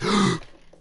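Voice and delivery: loud, sharp gasp. Real, not acted. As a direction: suck in a loud, startled breath. Gasps between sentences while playing.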